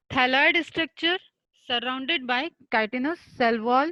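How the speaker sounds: very slow, every word spaced out for students to write; dictating a definition. Shown as a demonstration. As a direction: spell it out slowly for notes. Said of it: "definition of fungi". (परिभाषा धीरे-धीरे लिखवाना)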